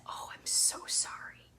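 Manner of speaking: soft whispered sympathy; tender whisper. Retold or imitated. Comforting a woman after her miscarriage, tender whisper. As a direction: hush it like comforting someone close.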